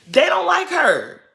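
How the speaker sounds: high and loud, slowed for emphasis. Real, not acted. Telling Monique the girls don't like Winter, high and loud. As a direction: hammer the point home. Repeats point, louder take.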